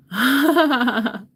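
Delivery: high, loud burst of laughter; big laughing outburst. Real, not acted. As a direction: laugh out loud in a long peal. Laughs after confession.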